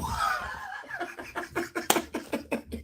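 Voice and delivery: real laughter. Real, not acted. Laughing at the joke.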